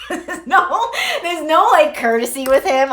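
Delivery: giggling through the confession; laughing. Real, not acted. Vlogger laughing about hanging up on her friend, high laughing burst.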